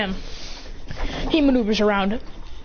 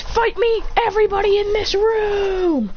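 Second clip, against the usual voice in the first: high, drawn-out yell to a whole room; shouted challenge; 10 semitones higher, slower than usual. Acted. Fighter challenging everybody in the room, high drawn-out yell. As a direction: call everyone out to fight, slowly.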